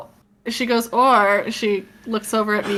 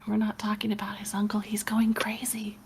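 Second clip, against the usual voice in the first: whispering; 5 dB quieter, faster than usual. Real, not acted. Aside to the table.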